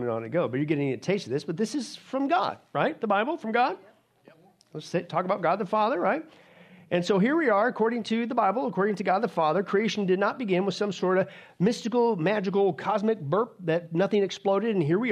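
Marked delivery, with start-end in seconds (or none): none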